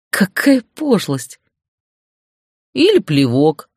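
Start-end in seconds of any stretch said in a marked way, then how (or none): 2.7-3.7 sneering little jab; mocking. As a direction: say it with a mocking edge. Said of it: "с издёвкой добавил собеседник".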